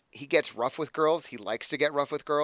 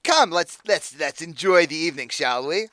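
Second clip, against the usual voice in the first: higher and louder put-on accent; accented villain coaxing; 5 semitones higher, 8 dB louder than usual. Patron urging her to enjoy the evening, accented coaxing. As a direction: coax with a cold edge. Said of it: "Doing this voice is gonna kill me".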